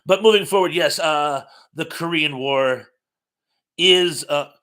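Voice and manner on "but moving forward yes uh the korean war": usual speaking voice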